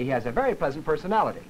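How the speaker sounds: PERSONALITY falling all the way to the bottom, quickly said; full final fall. Shown as a demonstration. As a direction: drop "personality" right down and off into silence. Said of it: "come all the way down to pitch one".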